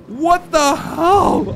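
What the hell? stretched out slowly, pitched high; slow, stunned What the hell?; drawn-out disbelief. Real, not acted. Stunned player drags out What the hell? slowly in disbelief.

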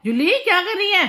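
high, loud call to the dog. Real, not acted. Mother calling the dog Julie, high and loud. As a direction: call the dog sharply from across the room.

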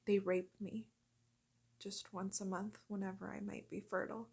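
very soft, near-whispered dramatic monologue with long pauses; hushed, grave confession. Acted. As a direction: confide the horrors under your breath, haltingly. Acted dark monologue of a captive.

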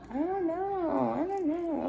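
high, slow, soft whine; whining child. Retold or imitated. A child whining to a parent, high and slow. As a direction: drag it out like a sulky kid. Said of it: "whining and complaining".

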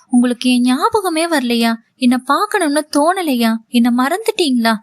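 ordinary delivery, nothing marked

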